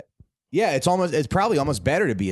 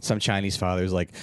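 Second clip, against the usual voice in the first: ordinary talk, nothing marked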